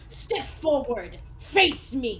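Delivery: slow, ringing summons; stern duelist's challenge; challenging knight. Acted. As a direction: call out your opponent, word by word. Knight summons his opponent to duel.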